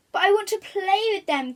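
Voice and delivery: whining cry. Acted. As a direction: protest loudly, nearly in tears. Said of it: "cried the crocodile".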